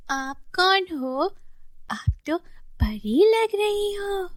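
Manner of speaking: put-on high, slow childlike voice; high-pitched acted child, drawn out; wondering little girl. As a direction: play a small child in awe, stretching the words.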